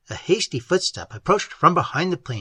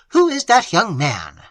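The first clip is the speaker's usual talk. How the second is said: louder, higher, prying and disapproving; prying censure; 4 semitones higher, 6 dB louder than usual. A narrator's reading. Aunt questioning niece about the visitor, prying and censuring. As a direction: demand it with nosy disapproval. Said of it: "in an accent which equally implied inquisitiveness and censure".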